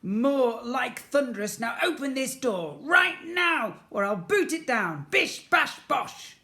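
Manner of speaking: high, loud bossy threat; threatening sister. A narrator's reading. Jemima threatening Ned to open the door, loud.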